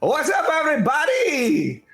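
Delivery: high, loud greeting to the whole bar; big entrance shout. Acted. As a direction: shout hello as you burst through the door. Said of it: "he steps inside and he says".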